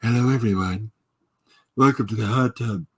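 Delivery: lightly put-on young man's greeting; a hint of his mock younger voice; younger self. Streamer demonstrating the voice he had as a young man, mock youthful voice. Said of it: "the voice I had as a young man".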